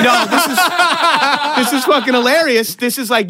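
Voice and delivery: cracking up at what seems a joke; high laughing disbelief. Retold or imitated. Laughing at supposed bit.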